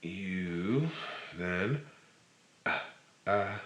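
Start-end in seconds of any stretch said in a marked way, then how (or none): 2.7-3.6 ah, ah from the throat; saying ahh. Shown as a demonstration. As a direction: open throat ah, ah. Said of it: "break a seal of air".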